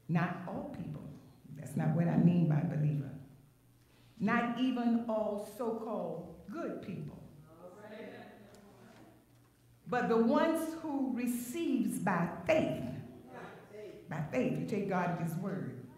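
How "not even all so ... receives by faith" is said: measured, softer retake with breaks between phrases; deliberate teaching pace; slowed down. Real, not acted. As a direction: take it phrase by phrase for the listeners. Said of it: "I'm gonna slow it down".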